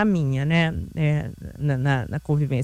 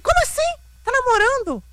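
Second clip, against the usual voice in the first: much higher, incredulous retold gasp; higher-pitched mock astonishment; shocked disbelief. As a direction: replay your own shock at the news. Retelling her shocked reaction.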